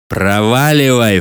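slow, drawn-out dismissal; doorman's contemptuous brush-off; scornful snort. A narrator's reading. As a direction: wave him off with scorn. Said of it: "фыркнул швейцар".

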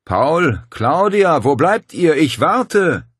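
hoher, lauter Geisterruf; unwirklich hallende Stimme. A narrator's reading. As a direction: aus der Tiefe der Höhle locken, laut rufend. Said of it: "Die seltsam unwirklich hallende Stimme rief". (unwirkliche, rufende Geisterstimme)

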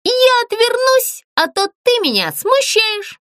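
shy little puppet turning her back; high puppet voice. Acted. Puppet tells the ghost he embarrasses her, high and coy. Zlyunya turns away shyly.

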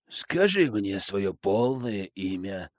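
slow, steady, even command; calm measured tone. Acted. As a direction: speak calmly and deliberately. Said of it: "спокойным, размеренным тоном".

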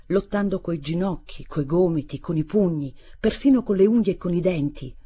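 ordinary delivery

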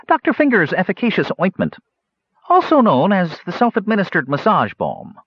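quick, high little gnome voice; a chipper gnome proudly naming his balm; eager gnome. A narrator's reading. Gnome to Kitiara, a high, eager gnome voice.

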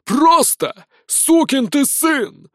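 high, slow roar from far off; distant shout. Acted. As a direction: yell it across the field. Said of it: "раздался вдалеке голос".

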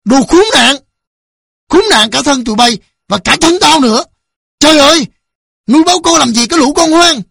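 loud, high, raging tirade; enraged father yelling abuse; furious shouting. A narrator's reading. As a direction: curse them at the top of your voice. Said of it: "Ông Thiện quát lớn".

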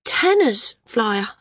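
put-on high-pitched, cowed girl; a touch of fear, voice jumping high; quailing girl. A narrator's reading. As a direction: answer as a girl shrinking back. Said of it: "The girl quailed".

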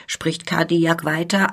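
usual speaking voice

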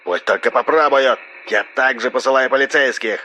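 high, filtered telephone voice; threatening phone voice. A narrator's reading. RS threatens police against rebels, menacing phone voice. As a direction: threaten sharply down the line.

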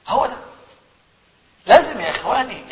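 ordinary delivery

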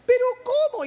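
mock a helpless high-voiced question; effeminate little voice. Preacher imitating the man asked to practice, high effeminate protest. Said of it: "con una vocecita".

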